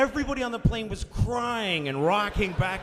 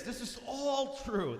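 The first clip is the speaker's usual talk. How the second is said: normal talking voice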